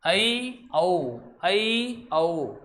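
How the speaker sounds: ऐ and औ as separate, drawn-out gliding vowels; careful vowel demonstration. Shown as a demonstration. Teacher tells students to mind the pronunciation, careful vowel demonstration. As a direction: sound out ऐ as "a-ee", औ as "a-oo". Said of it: "उच्चारण".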